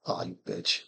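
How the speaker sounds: hushed, annoyed swear; whispered curse. Real, not acted. Player reacting to opponent's card, whispered curse. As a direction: curse the opponent under your breath.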